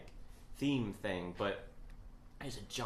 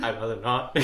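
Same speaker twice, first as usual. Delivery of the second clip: loud refusal; 10 dB louder than usual. Retold or imitated. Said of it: "And I'm like".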